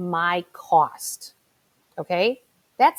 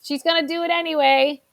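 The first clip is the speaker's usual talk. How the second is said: louder, higher teasing warning; boyfriend's loud aside; 6 semitones higher, 5 dB louder than usual. Retold or imitated. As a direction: call out that she'll do it anyway. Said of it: "my boyfriend says".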